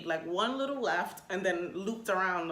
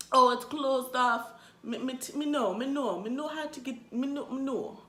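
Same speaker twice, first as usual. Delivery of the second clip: higher-pitched, badly faked Jamaican accent; shaky Jamaican accent; 5 semitones higher than usual. Cab driver insisting he knows the way, bad Jamaican accent. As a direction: stumble through a poor Jamaican impersonation. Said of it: "My Jamaican accent is not popping".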